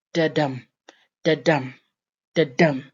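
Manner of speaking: each da-dum with a short da and a stressed dum; iambic rhythm demo. Shown as a demonstration. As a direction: beat out da-dum like a pulse, weight on DUM. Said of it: "type of rhythm".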